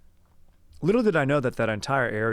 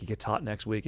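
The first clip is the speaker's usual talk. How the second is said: usual speaking voice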